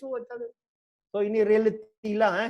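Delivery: ordinary talk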